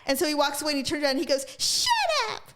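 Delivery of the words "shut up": whiny, petulant snap; a hint of a bratty preppy guy; bratty shut up. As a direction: sneer it like a spoiled brat. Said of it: "Like that, like a bitch".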